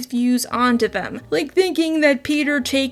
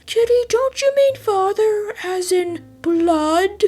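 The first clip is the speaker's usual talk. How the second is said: mock heckler voice; 8 semitones higher than usual. Heckler voice questions her.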